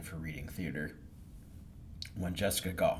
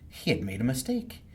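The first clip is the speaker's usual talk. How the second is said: usual speaking voice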